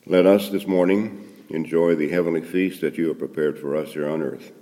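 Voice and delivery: normal talking voice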